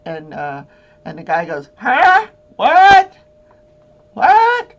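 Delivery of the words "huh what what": high, loud mock yell of a deaf man who can't hear; deaf man shouting. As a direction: bellow "what?" like you can't hear a thing. Deaf witness mishearing in court.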